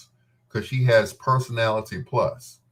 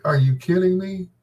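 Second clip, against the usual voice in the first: higher, louder startled question; shocked disbelief; 9 semitones higher, 3 dB louder than usual. Retold or imitated. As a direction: ask it in real disbelief. Reaction to news of death.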